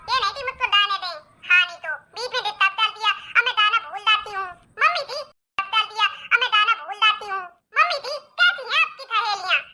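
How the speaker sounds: indignant lisping daughter-in-law; lisping complaint. Acted. Daughter-in-law scolding aunties for interrupting her song, lisping. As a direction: lisp the complaint, consonants turned babyish. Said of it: "तुम्हारे चक्कर में मैं भी तोतली".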